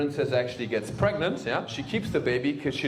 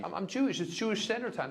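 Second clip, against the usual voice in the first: mocking excuse; 7 semitones higher, 10 dB quieter than usual. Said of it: "when people blame it".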